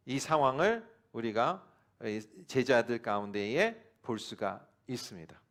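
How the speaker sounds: normal talking voice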